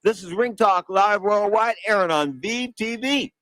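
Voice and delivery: big high ringside announcer call; lucha libre announcer. Acted. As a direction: boom it out like a wrestling ring announcer. Host's own show opening.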